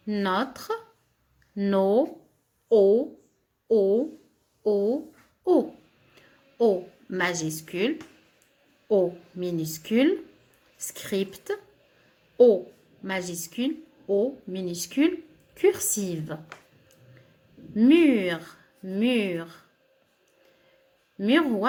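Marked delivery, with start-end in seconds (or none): 2.7-5.7 O called out loud and drawn out; teacher calling out the letter O; sounding out O. Shown as a demonstration. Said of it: "teacher sounding out the letter". 17.8-18.4 Mur said loud and clear; loud model word. Shown as a demonstration. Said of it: "teacher modelling the word".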